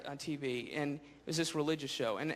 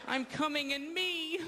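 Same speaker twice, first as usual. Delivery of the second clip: mock ecstatic gym guy; 11 semitones higher, slower than usual. Gym guy fantasizing in mirror.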